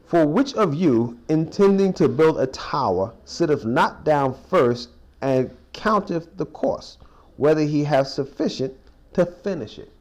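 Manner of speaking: slow, deliberate Bible reading aloud; scripture reading. Acted. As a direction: read the verse slowly and solemnly. Reads scripture verse aloud.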